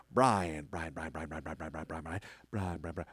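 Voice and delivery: Southern elf muttering the name; mumbled name repeat. Retold or imitated. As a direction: mutter the name over and over as if checking a list. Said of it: "he'll go".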